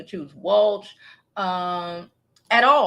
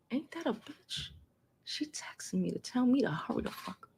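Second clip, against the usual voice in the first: unvoiced aside whisper; 12 dB quieter than usual. Real, not acted. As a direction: say it under your breath. Aside about kid texting.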